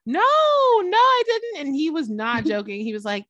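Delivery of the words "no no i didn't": high, louder, flustered interviewer; hammed-up nervous denial from the interviewer; mock flustered denial. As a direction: deny it in a panicky squeak. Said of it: "she was like".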